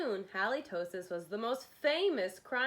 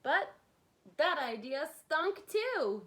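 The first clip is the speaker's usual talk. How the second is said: louder, slow "that idea stunk too"; emphatic punchline; 6 semitones higher, 5 dB louder than usual. Acted. Narrator to kids, landing the joke loud and slow. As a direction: land the joke slowly.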